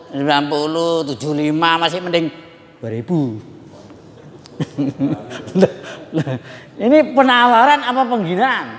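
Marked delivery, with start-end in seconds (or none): 4.6-5.6 short laugh. Real, not acted. Audience-facing laugh mid story. 5.6-8.7 a sharp, offended rise on the question; indignant retort. Retold or imitated. Seller's indignant retort in story.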